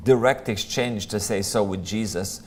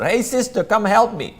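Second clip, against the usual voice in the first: higher, raised mock call to a sister; 9 semitones higher, 3 dB louder than usual. Martha calls Mary to come help, a raised mock call.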